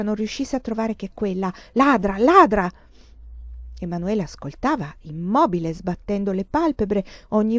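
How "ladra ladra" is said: Ladra! Ladra! spat out much louder, falling hard; furious insult. A narrator's reading. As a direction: hurl the word Ladra, choking with rage. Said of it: "confusa dall'ira". (Ladra! Ladra! gridato forte, con ira, in discesa)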